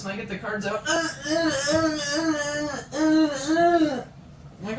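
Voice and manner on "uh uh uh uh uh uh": loud, high, drawn-out mock grunts; imitated wordless moans. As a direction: mimic moaning, high and very loud. Imitates classmate's wordless reply.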